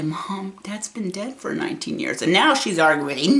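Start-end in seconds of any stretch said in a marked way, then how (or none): 0.0-2.2 much softer, careful breaking of news; quiet, tender hard news; gentle quiet telling. Retold or imitated. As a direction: say it softly to a grieving mother.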